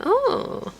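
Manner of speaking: high squeak of surprise; a sudden realising oh, pitched high; mock startled oh; surprised oh. Shared realisation voiced.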